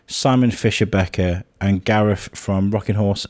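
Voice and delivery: usual speaking voice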